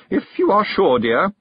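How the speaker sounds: high, doubtful fluting, falling at the end; helpless. A narrator's reading. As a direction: yield politely but doubtfully. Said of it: "she said helplessly".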